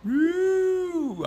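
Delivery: a long high whoop of joy; excited cheer. Real, not acted. Vlogger celebrating passing his firefighter physical, whooping.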